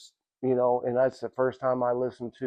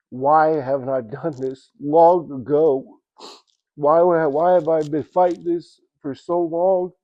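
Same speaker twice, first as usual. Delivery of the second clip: excited outburst; 4 semitones higher, 6 dB louder than usual. Retold or imitated. Overjoyed outburst on the drive.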